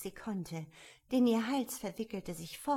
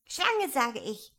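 plain reading voice

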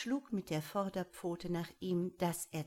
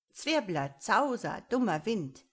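loud, indignant name-calling; punchy, cross squawking; scolding rant; 3 semitones higher, 7 dB louder than usual. A narrator's reading. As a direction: rail at the culprit, word by word. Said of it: "zeterte der Quetzal".

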